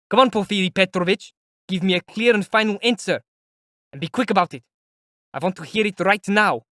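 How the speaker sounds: high, loud insistent demand; loud and earnest. Acted. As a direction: press him for an answer. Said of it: "he said, loudly and earnestly".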